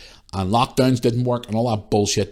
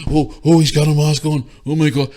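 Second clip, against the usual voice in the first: mock panic; 6 semitones higher, 5 dB louder than usual. Mimics panicked shoppers seeing masks.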